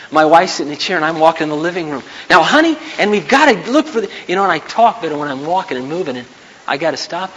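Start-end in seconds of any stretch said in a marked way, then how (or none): 2.3-4.1 high, projected speech to one listener; public-speaker voice at home. Acted. Husband pacing the living room at his seated wife, public-speaker voice. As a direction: address your wife as if she were an audience. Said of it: "I'll talk like this at home".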